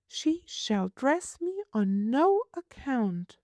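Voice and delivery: put-on high, prim little girl asking. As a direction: voice a fussy small girl, pitched high. Child voice.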